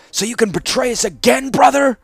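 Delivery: high, loud bitter snarl. A narrator's reading. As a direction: snarl back in accusation. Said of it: "his brother's voice snarl back".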